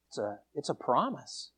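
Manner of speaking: ordinary talk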